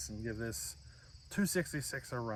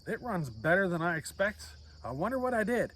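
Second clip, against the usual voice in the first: half-joking wonder climbing higher at the end; mock amazement; 6 semitones higher than usual. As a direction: play the builder delighted and baffled by his own saw. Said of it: "and you're like".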